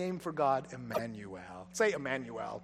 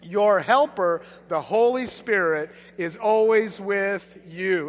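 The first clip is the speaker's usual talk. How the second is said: slow, louder unison reading; read together; 7 dB louder, slower than usual. Shown as a demonstration. Pastor leading the church in reading: slow, louder recitation. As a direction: read it aloud as one congregation. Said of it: "Let's read this together".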